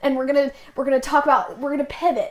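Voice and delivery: hurried switch. Retold or imitated. Missionary abruptly switches the lesson plan, hurried and loud. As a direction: rush it out as plans change.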